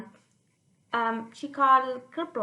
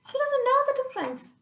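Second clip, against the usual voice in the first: higher, fast mock grandma grumble; mock complaining grandma; 13 semitones higher, 4 dB louder, faster than usual.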